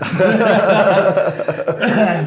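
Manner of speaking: loud, high burst of laughter; hearty laugh. Real, not acted. Laughs after admitting pizza.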